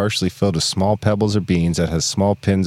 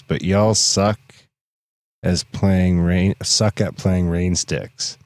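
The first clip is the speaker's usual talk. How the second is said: slow mock scolding; much slower than usual. Mock scolding the rain stick players.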